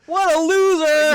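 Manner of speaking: high, loud, slow mocking exclamation; teasing the friend's dad; mocking jab. Real, not acted. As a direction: drag out the insult for laughs.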